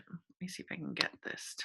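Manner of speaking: whispering to herself; under her breath, unvoiced; whispered aside. Real, not acted. Aside while adjusting camera.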